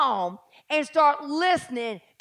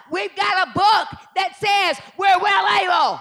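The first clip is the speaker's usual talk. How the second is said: loud, declaring it; loud joyful preaching; preaching shout; 5 semitones higher, 6 dB louder than usual. Real, not acted. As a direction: shout it out to the church. Preaching climax.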